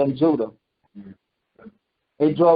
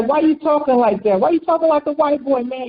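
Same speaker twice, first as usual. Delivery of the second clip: higher, quicker imitation of hecklers; pitched-up incredulous questioning; mock accusing challenge; 7 semitones higher than usual. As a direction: needle him for putting on airs. Acting out people mocking changed speech.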